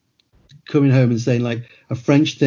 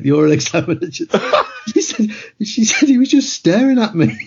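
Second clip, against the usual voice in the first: cracking up mid-story, voice pitched up; laughing while talking; 8 semitones higher than usual. Real, not acted. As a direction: barely get it out for laughing. Cracks up telling daughter's exam story.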